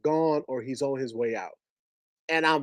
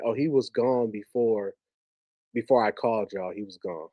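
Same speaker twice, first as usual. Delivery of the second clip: low, softened voice, sinking at the end; subdued, imitating a mother giving bad news; subdued retold news; 5 semitones lower, 5 dB quieter than usual. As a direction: break the death news softly and low. Mother breaking news of death.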